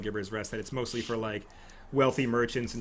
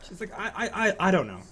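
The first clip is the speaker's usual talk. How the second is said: higher, stammering, nervous laugh; flustered noblewoman; 7 semitones higher than usual. Acted. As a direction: caught off guard, fumbling. GM voices the noblewoman.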